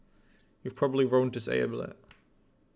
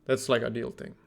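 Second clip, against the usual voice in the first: ordinary delivery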